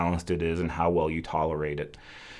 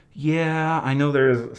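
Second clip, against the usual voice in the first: ordinary talk, nothing marked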